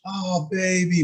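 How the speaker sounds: put-on high, doting coo to a little girl; tender coo. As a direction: coo it like comforting a hurt toddler. Father coddling a fallen daughter.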